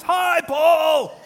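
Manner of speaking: loud, high shouted hello; shouted greeting. Retold or imitated. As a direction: yell it across the room like a keen congregant. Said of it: "he shouted at the top of his lungs".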